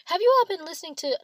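high, loud and fast; excited. Real, not acted. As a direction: burst out with gossip. Excited gossip opener.

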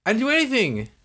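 high-pitched, incredulous protest; indignant protest. Retold or imitated. Student singled out by the teacher, high indignant protest. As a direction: protest your innocence, pitch shooting up.

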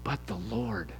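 low, soft and solemn; hushed quotation. Acted. Preacher shares a hopeful scripture phrase, hushed. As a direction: let the phrase drop quietly.

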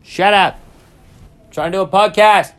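real irritation, yelled out; annoyed shout. Real, not acted. Yelling at a loud announcement.

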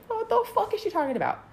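high-pitched retold disbelief; high, incredulous aside; bewildered. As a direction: squeak out the question in mock bewilderment.

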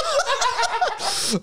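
squealing laughter; high laugh. Real, not acted. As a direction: cracks up hard. Abbreviation joke cracks them up.

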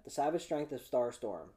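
title run together in one go. Shown as a demonstration. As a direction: say the whole title straight through. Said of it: "Let's try that".

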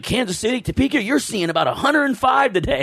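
high-pitched imitation of the TV weatherman. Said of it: "and he's like".

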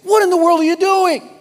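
high, loud shocked lady's voice; a scandalised woman crying out in horror; horrified lady. Acted. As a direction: shriek it like an appalled shopper.

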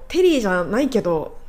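ordinary talk, nothing marked

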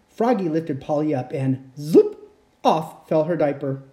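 usual speaking voice

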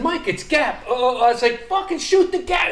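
high, loud urgent order; exasperated retold shout. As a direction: act out hollering instructions at a clueless teammate.

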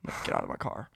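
hushed warning whisper. Retold or imitated. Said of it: "I'm just going".

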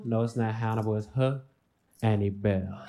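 suave fake French accent. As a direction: purr it with French charm. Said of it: "a French accent".

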